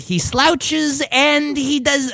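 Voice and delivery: high, slow imitated mom; mom listing clues. Son imitating mom describing an actor, high slow voice. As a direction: drag it out high, hunting for the name. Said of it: "She'll, like, do is".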